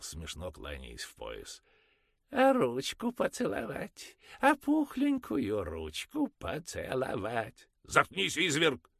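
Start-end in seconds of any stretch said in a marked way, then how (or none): 2.3-7.7 high, slow buffoon voice; clowning falsetto. Acted. Father clowning about kissing the priest's hand, high. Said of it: "заскоморошничал". 7.8-8.9 much higher and louder snap; angry snap. Acted. Mother shutting the father up, angry. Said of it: "зло осадила его мать".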